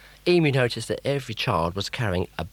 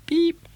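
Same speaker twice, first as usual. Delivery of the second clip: high beep; 6 dB louder than usual. Acted. Machine noise.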